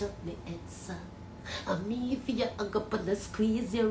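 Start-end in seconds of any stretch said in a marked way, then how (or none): none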